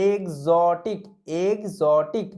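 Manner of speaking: এক্সোটিক said as egzotik, g-z sound; demonstrated pronunciation. Shown as a demonstration. Teacher drills students on এক্সোটিক as egzotik. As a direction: repeat এক্সোটিক twice as egzotik. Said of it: "একই রকমভাবে এক্সোটিক".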